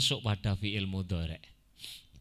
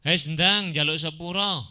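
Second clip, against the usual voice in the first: higher, louder rough order; harsh demand; 6 semitones higher, 7 dB louder than usual. Shown as a demonstration. Teacher demonstrates a rude demand for an apology, harsh. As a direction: bark it as a demand. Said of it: "tapi intonasi yang kasar".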